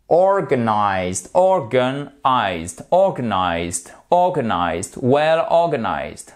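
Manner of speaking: slow "organized", middle A weakened to a schwa; reduced-vowel demo. Shown as a demonstration. Teacher drilling for learners, "organized" with a weak schwa for A. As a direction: stress OR, soften the A in "organized" to "uh". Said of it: "le A ici se prononce comme un E atténué".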